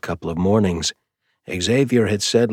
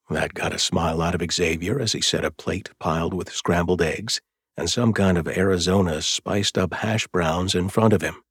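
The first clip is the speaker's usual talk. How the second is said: ordinary delivery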